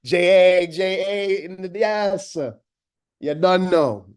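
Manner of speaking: a touch of Jamaican patois, hyped; Jamaican accent. Real, not acted. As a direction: slip into your Jamaican accent. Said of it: "my accent now".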